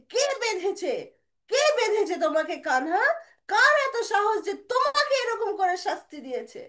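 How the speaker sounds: loud, high, indignant demand; angry yelling. Acted. Big brother demanding who tied up Krishna, furious shouting. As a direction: storm in demanding the culprit. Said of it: "আর রেগে রেগে চিল্লিয়ে চিল্লিয়ে আসছে".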